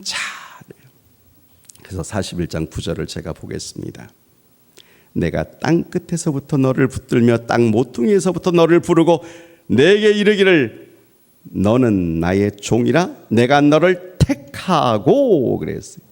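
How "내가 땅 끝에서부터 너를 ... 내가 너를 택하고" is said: 1 solemn scripture reading. Acted. Reading God's words dramatically.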